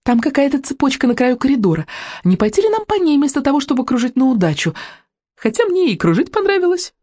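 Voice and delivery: high, squeaky dragon voice; small female dragon. Acted. Dragon suggests following the chain, high and squeaky. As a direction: pipe it up high like a little creature. Dragon Буремуха speaks to the children.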